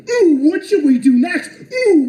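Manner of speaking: mock scheming coo, high and loud; mocking ooh. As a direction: sneer like a gleeful plotter. Mocking critics conspiring.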